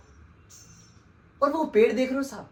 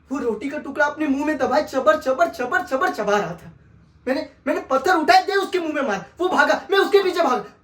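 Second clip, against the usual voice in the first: louder, higher, quick and urgent; frantic retelling; 4 semitones higher, 6 dB louder than usual. Acted. Telling the sahib he chased the dog, frantic. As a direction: rush it like the chase itself.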